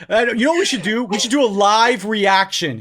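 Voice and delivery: giddy, loud and high; real excitement. Real, not acted. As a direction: burst with glee at the idea. Thrilled at hearing about tape.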